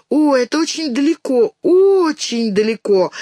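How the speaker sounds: стретч on очень, slow and loud; drawn-out очень. A narrator's reading. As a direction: stretch out очень, long vowels. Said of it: "растягивая слово «очень»".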